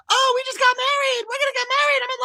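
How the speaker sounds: put-on high gushing girlish voice; mock Anna gushing. Anna mocked to his friends, gushing high. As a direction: gush like a lovestruck princess. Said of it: "she's like".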